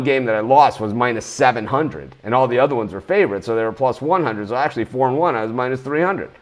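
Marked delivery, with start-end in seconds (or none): none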